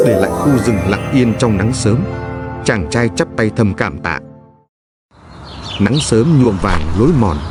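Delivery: plain reading voice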